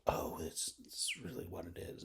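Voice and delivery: breathy near-whisper mocking the teachers, soft; mock dismissive teacher. Mocking the school's social science teachers in a soft airy whisper. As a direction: brush it off in an airy half-whisper. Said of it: "all the little social science is like".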